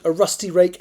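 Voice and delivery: usual speaking voice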